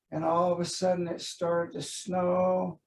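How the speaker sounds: ordinary delivery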